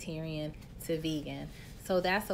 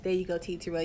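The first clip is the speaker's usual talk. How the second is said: ordinary talk, nothing marked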